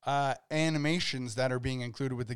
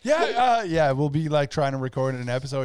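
ordinary delivery

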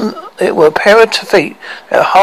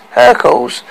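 ordinary delivery

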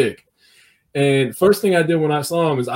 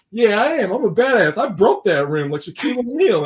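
put-on cocky kid; 8 semitones higher than usual. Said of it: "this attitude".

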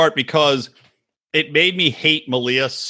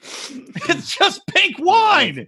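high, very slow, wailing mock despair; mock anguished wail; 13 semitones higher, much slower than usual. Pleading with the shop owner, mock wailing. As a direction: wail it like a broken man. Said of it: "I was like".

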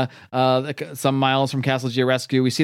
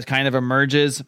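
ordinary talk, nothing marked

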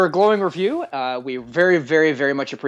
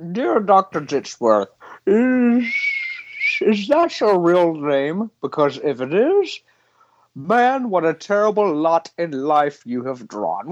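mock letter reading. Reading listener email in character.

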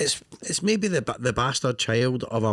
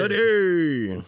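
drawn-out referee call; announcer voice; 7 semitones higher than usual. Pretend. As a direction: echo the game show call.

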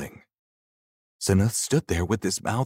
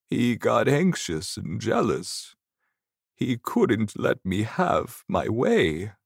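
put-on higher-pitched old storyteller; old man; 5 semitones higher than usual. Old ghost telling Seneth his story, old man voice. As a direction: tell it as an old ghost recalling his life. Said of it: "Ahan began to tell his story".

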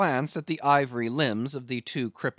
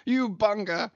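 high, hearty captain with a laugh; jovial teasing captain; 10 semitones higher than usual. A narrator's reading. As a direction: abuse your friend with gleeful affection.

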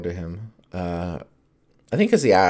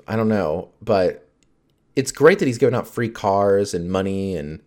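normal talking voice

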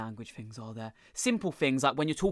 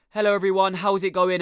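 raised, louder nasal greeting; 5 semitones higher, 5 dB louder than usual. Shown as a demonstration. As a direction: talk through your nose, pitched up. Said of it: "when you talk through your nose, you talk a little bit more like".

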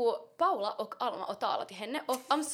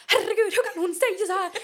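mock shocked outcry; 8 semitones higher, 6 dB louder than usual. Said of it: "Alma är så här".

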